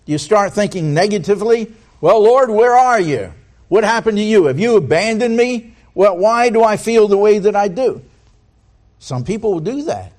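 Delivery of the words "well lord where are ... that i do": raised, louder pleading to the Lord; mock despairing complaint. A doubting believer complaining to the Lord, mock despairing. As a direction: voice a believer whining at God.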